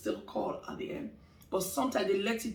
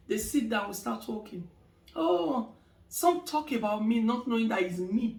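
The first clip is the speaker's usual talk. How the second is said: normal talking voice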